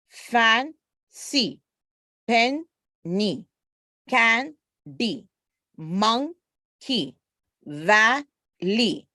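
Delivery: each word from "fancy" to "valley" broken into two beats; first half rises, pause, second half falls, as in "fan... cy"; split into syllables. Shown as a demonstration. Teacher drilling two-syllable words, "penny" as pen, gap, nee. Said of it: "Two syllables".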